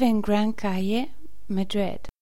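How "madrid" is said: usual speaking voice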